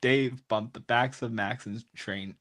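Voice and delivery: ordinary talk, nothing marked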